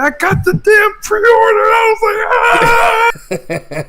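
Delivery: very high, triumphant scream; ecstatic shouting. Retold or imitated. Telling the group chat about the pre-order, ecstatic shouting. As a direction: shout the good news, then scream it out. Reenacting telling friends he got it.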